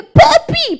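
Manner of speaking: very high, loud whining Papi; hammed-up girlish call; mock whiny daughter. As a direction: squeal Papi like a clingy young bride. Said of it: "ustedes estén que".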